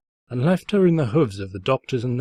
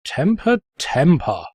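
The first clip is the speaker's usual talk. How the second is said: sing-song chiding, high on the first word; mocking tut; 5 semitones higher, 3 dB louder, slower than usual. Narrator taunting the angry Twilight, mocking chide. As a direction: tease her like a naughty child. Narrator mocks her anger.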